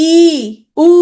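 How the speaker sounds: ই, then উ, each held long; high vowels. Shown as a demonstration. Teacher demonstrating high vowels for students, long ই and উ. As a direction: sustain ই and উ separately, tongue high. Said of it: "উচ্চারণ করলাম".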